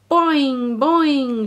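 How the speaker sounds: playful mouse boing; bouncing mouse. A narrator's reading. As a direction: bounce the words like a trampoline. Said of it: "she bounced, laughing loudly".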